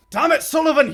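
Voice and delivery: high, loud exasperated scolding; angry shout. Acted. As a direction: bark it at the fool. Captain scolding sailor.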